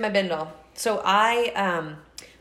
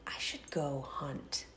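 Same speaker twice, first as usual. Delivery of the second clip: whispering; 4 semitones lower, 15 dB quieter, much slower than usual. Retold or imitated. Thinking to herself, hunting for makeup.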